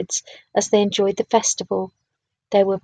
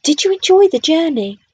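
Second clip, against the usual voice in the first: higher, louder, smiling question; cheerfully eager to hear; happy; 7 semitones higher, 5 dB louder than usual. A narrator's reading. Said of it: "with a happy smile".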